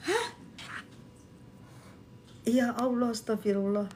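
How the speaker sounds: usual speaking voice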